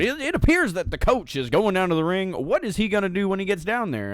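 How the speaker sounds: hammed-up J R calling the action; J R impression. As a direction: play a puzzled excited commentator. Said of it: "is like".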